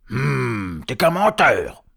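growing from a hum to a loud, high insult; hum, then burst out accusing; angry troll. Acted. Léon insulting Hubert, loud indignation. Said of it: "trois trolls en colère".

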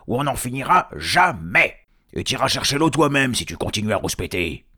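loud, high scolding; angry trolls. Acted. Trolls threatening Tom with fetching water, loud scolding. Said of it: "trois trolls en colère".

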